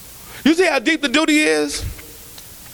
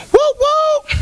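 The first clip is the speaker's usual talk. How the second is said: very high, loud party woo; club whoop; 14 semitones higher, 4 dB louder than usual. Retold or imitated. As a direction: holler like the crowd at a late-night spot. Mimicking the after hour club.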